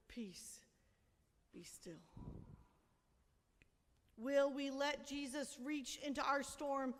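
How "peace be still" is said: much softer and lower, almost breathed; hushed. Acted. Pastor recalling Jesus in her chaos, hushed. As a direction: breathe it like a remembered inner voice. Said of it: "And I heard".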